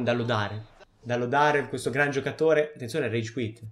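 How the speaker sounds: ordinary talk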